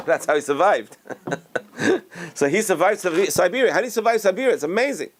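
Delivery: laughing, high-pitched marvelling; amazed and tickled; amused amazement. Real, not acted. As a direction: tell it through chuckles, voice climbing. Amused marvelling at survival story.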